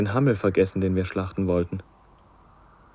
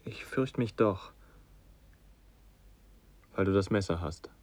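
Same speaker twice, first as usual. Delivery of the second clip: quiet fear; 2 semitones lower, 9 dB quieter than usual. Acted. Son afraid of the knife.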